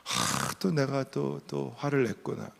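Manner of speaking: sighing, soft retold self-blame; self-reproach. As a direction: quietly scold yourself, alone. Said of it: "혼자서 제가 제 방에서 막 자책하면서".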